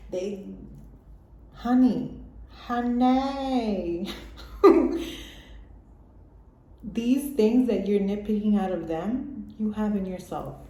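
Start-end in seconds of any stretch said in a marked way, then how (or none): 1.5-4.1 higher, louder, knowing "honey"; teasing honey. Real, not acted. Teasing the nitpicking listener. 4.1-5.7 high unvoiced burst of laughter; breathy laugh. Real, not acted. Laughs after teasing.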